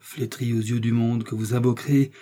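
ordinary delivery, nothing marked

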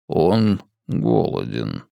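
low, slow, soft alien voice; a deep voice from within; alien inner voice. A narrator's reading. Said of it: "голосом, который не принадлежал ей".

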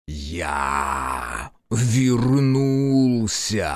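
slow, drawn-out monstrous roar; demon voice. Acted. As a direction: stretch every sound like a giant demon. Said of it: "Раздался громкий голос демона".

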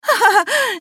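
high-pitched derisive cackle; mocking laugh. A narrator's reading. Liza laughs at the threat to tell, mocking. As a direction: laugh high in her face.

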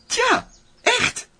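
squeaky aside. Acted.

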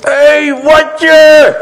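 high, loud teasing call; mock shout. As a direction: yell the nickname across the street. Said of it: "en dan zeg je".

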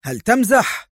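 high, biting retort; sharp. A narrator's reading. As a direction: snap it back in disbelief. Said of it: "قال مايكل في حدة".

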